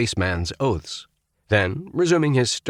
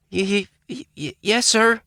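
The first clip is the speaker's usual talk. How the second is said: frightened stammer, much higher. A narrator's reading. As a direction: stutter it out in fright. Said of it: "finally came a mumbled reply".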